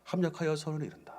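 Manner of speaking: soft inner voice. Retold or imitated. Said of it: "그런 마음을 주었어요".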